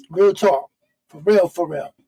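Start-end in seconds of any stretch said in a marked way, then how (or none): none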